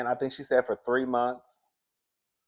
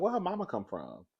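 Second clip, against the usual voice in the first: puzzled aside; 6 semitones higher, 8 dB quieter than usual. Retold or imitated. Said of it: "I was like".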